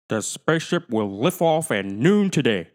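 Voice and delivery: loud, formal news voice; newscaster. Acted. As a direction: announce like a TV newscaster. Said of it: "said the newscaster".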